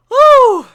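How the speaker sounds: high, loud delighted whoop. Real, not acted. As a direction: let out a big whoop of joy. Reacting to funny line.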